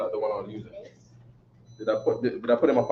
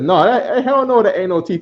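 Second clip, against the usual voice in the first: higher, scoffing denial of the reading; a hint of a scandalised scholar, pitched higher; mock indignant scholar; 7 semitones higher than usual. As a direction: play the baffled scholar refusing it. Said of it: "He says".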